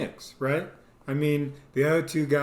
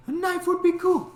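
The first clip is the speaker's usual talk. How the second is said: much higher, dreamy thought voice; goofy high inner voice; 3 dB louder than usual. Retold or imitated. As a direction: say it like a giddy kid.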